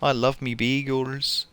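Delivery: slow, lilting Irish brogue as a leprechaun; hammed-up Irish leprechaun; playful stage Irish accent, drawn out. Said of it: "It is an Irish accent".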